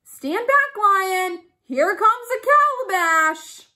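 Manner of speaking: high, loud warning call. A narrator's reading. Turtle calling down to lion from tree, loud call. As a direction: shout down from up high. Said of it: "on the gourd and called".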